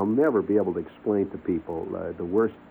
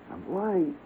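ordinary talk

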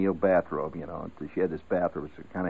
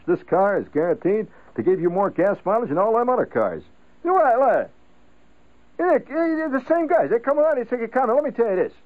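much higher, sputtering outrage; father fuming over the newspaper; bugged father; 12 semitones higher, 4 dB louder than usual. Retold or imitated. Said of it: "this note in his voice".

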